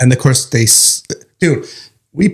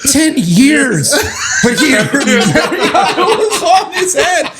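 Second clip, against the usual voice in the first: much higher, laughing shout; cracking up mid-story; laughing disbelief; slower than usual. Real, not acted. Laughing as he retells partner getting even. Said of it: "laugh shout".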